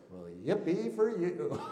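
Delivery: slow mock-cheery sarcasm; sarcastic yippee. Pastor retells his envious reply to a friend, slow and sarcastic. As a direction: drag out fake delight. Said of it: "my response, I think, was".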